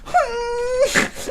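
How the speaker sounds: high whimpering hum through tears; a tearful little girl's whine; crying whimper. Retold or imitated. Girlfriend still crying at him, high whimpering hum. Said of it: "She sounded like that".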